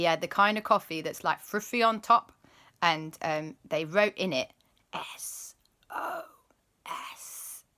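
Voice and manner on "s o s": breathy secret whisper, much softer; ghostly whisper. Acted. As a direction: spell it out in a hush like a ghost. Ghosts write secret message.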